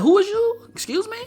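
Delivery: very high, indignant squawk; mock ignorance. Vlogger pretending to disown the accomplice, high mock indignation. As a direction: feign total ignorance in a squeaky high voice.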